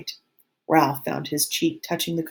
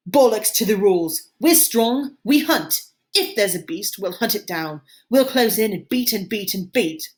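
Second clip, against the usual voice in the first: loud, high defiant shouting; rally the boys with a roaring shout; 6 semitones higher, 5 dB louder than usual. A narrator's reading. Jack shouting down the rules at the assembly, loud. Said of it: "Jack was shouting against him".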